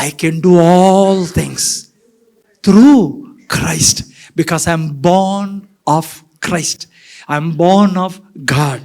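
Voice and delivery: each word drawn out, born stretched long; slow scripture quote. Acted. Quoting scripture slowly, word by word. (నెమ్మదిగా, ప్రతి పదం సాగదీసి బోధించడం)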